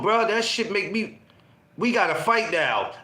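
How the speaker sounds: ordinary talk, nothing marked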